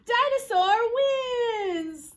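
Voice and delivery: loud, high, long-drawn victory cry; big slow cheer; triumphant announcement. A narrator's reading. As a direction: announce the champion to little kids.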